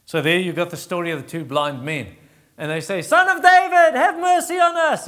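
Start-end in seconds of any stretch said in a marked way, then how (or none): 3.0-5.1 much higher, loud crying out; desperate shout. Retold or imitated. Blind men shouting after Jesus, desperate. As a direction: shout for mercy across the crowd.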